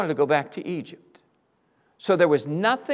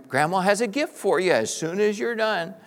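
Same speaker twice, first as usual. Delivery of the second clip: ordinary talk, nothing marked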